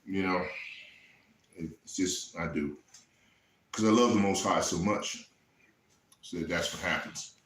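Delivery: choked up, barely getting the words out; emotional. Real, not acted. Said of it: "I get emotional about this situation".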